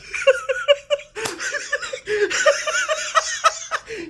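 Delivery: long high-pitched laugh. Real, not acted. Tickled by his own big-win story, laughing.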